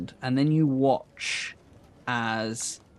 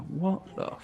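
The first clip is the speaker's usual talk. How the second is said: high, hushed character voice; Sildar; 7 semitones higher, 5 dB quieter than usual. Acted. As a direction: pitch it up high and keep it soft. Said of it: "you just hear Sildar go".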